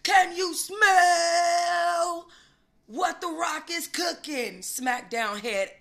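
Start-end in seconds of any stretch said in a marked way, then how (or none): 0.0-4.6 booming wrestler shout, stretched out slow; hammed-up The Rock catchphrase; The Rock impression. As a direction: bellow it like a wrestling promo. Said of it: "the full smackdown".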